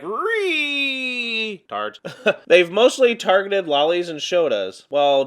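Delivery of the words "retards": mocking voice. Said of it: "That's how they all sound".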